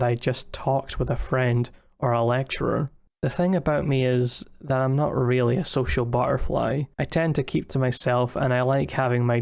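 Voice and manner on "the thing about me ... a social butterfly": usual speaking voice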